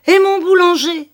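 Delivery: high, loud alarmed shout; old man suddenly cries out; sudden cry. A narrator's reading. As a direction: blurt it out, suddenly remembering. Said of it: "s'écria-t-il".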